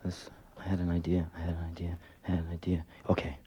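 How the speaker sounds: very quiet, low whisper to himself; whispered muttering. Acted. Man alone with a thought after pausing, whispered to himself. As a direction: repeat it under your breath.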